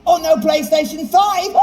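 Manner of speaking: ordinary talk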